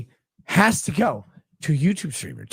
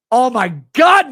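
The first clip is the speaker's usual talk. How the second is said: normal talking voice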